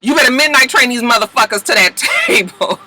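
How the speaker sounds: loud, indignant shout with a laugh; outraged shout. Retold or imitated. Kelly yelling about her cupcakes, loud and outraged. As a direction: yell it, fed up and laughing. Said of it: "She was like".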